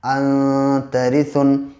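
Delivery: अन तरिसुन with the n held in the nose, very slow; slow nasal ikhfa demo on अन तरिसुन; nasal ikhfa hold. Shown as a demonstration. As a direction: hum the n of अन through the nose before तरिसुन. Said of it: "नाक में आवाज़ को ठहराते हैं".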